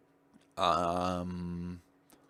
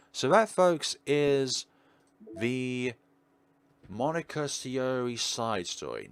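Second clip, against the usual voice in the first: loud high showman wrap-up; big presenter sign-off; host announcement; 4 semitones higher, 4 dB louder, slower than usual. Real, not acted. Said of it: "announces end like a host".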